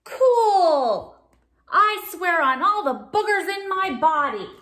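loud, high excited vow; gleeful shark. Acted. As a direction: swear it with glee. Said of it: "said the shark".

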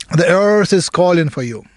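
meditation guide with long pauses between phrases; guided meditation. Real, not acted. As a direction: give each phrase weight, let it fall, then leave silence.

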